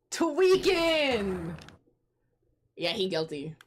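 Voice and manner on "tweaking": higher, long-drawn mock cry; hammed-up cry. Player reacting to the cornered villain, hammed-up cry. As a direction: draw it out high and exclaiming. Same word repeated as a cry.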